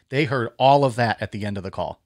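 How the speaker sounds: ordinary delivery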